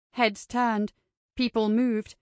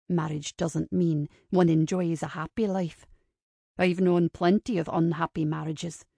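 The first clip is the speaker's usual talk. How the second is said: low male character voice, disagreeing; cynical man; 5 semitones lower than usual. Acted. Kincaid interrupts Ava's romantic view of marriage, low retort.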